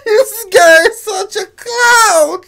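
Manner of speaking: slow, laugh-crying delivery. Real, not acted. Viewer calling the man a clown, crying laughing. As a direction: drag the words out through laughter.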